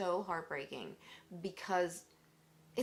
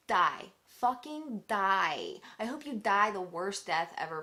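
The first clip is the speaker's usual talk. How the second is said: real anger; 5 dB louder than usual. Real, not acted. Speaker wishing death on child predators, furious and loud. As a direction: curse them with force, stretching the words.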